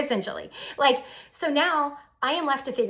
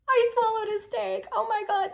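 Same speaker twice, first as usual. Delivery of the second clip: much higher, thrilled squeal; victorious excitement; 11 semitones higher than usual. Retold or imitated. Texting girlfriends after swallowing a steak, thrilled and high. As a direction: celebrate like you just won. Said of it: "it was like a victory".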